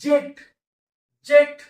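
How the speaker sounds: जेट called out high and loud, twice; blended word said aloud. Shown as a demonstration. Teacher calls out the finished word जेट loudly. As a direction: announce जेट loudly to the class. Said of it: "संपला शब्द".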